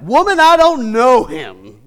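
loud, slow denial; Peter denying. Retold or imitated. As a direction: push her off, loud and slow. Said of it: "Peter denied it".

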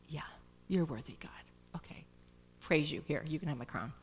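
low, soft, bored half-hearted praise; mock lukewarm praise. Preacher mocking half-hearted worship, bored and offhand. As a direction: shrug it off like a chore. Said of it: "They don't go".

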